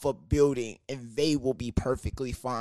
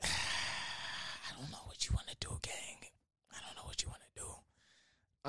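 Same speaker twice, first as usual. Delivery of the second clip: whisper; 14 dB quieter than usual. Retold or imitated. Exasperated sigh, whispered.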